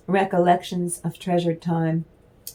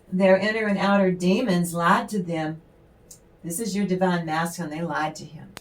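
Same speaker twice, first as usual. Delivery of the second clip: normal talking voice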